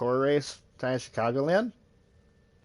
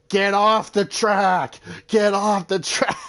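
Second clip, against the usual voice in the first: loud, higher, slow drawn-out yelling; hammed-up track rage; mock yell; 8 semitones higher, 8 dB louder, slower than usual. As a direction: bellow it at the driver in your way. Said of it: "he was yelling at Natalie Decker".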